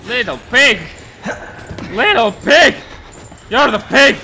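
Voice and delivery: high, loud taunting shout. Real, not acted. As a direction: yell back in defiance. Monster taunts, player shouts back.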